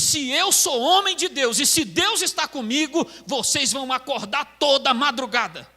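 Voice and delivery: re-enacted all-out dare; bold challenge. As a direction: throw down a challenge to the congregation. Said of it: "aí eu fui pra ignorância".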